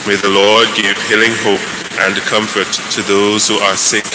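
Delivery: ordinary talk, nothing marked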